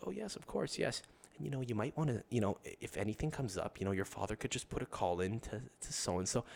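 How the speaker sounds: suddenly sweet, quiet and fawning; fawning secretary. Retold or imitated. Secretary perks up for the boss's son, fawning. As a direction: perk up and fawn over him. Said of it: "She's like".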